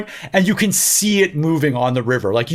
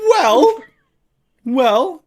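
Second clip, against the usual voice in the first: high, rising "well"; mock protest; 7 semitones higher than usual. Teasing co-host who doubts the week's news, mock protest. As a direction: squeak "well" upward in playful objection.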